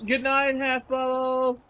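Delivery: talking while crunching chips. Real, not acted. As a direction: say it mid-bite with a mouth full of chips. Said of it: "I'm sorry for the crunching".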